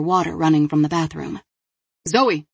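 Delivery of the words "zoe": calling a name through a door. Acted. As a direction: call out her name, raised. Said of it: "he called out".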